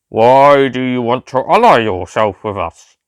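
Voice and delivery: very slow, flat robotic drone; robotic Cyberman voice. A narrator's reading. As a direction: speak like a cold machine, word by word. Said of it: "the cyber leader asked".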